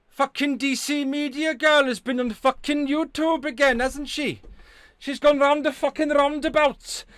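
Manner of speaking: high, loud put-on Welsh accent; sing-song Welsh gossip, pitched high. Shown as a demonstration. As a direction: lay on a thick, indignant Welsh accent. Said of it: "That's Welsh".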